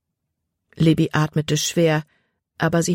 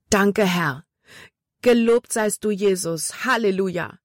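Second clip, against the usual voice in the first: fervent thanks to heaven; relieved thanks to God; grateful prayer; 4 semitones higher than usual. A narrator's reading. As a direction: pray with relief. Jordan prays, arms raised.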